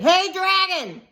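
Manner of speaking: high, loud call into his ear; shouted loud. Acted. Elizabeth yelling into the sleeping dragon's ear, high loud call. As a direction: yell right into the dragon's ear. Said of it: "she shouted as loud as she could".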